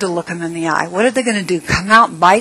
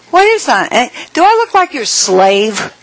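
much higher, louder mock outrage; indignant retort; 6 semitones higher, 4 dB louder than usual. Narrator balks at filling her bird feeders, mock indignant. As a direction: push back like an offended helper.

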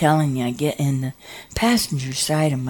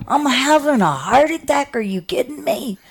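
panicked disbelief; 8 semitones higher than usual. Retold or imitated. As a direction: cry out in alarm, voice pitched up. Realizing a heart attack.

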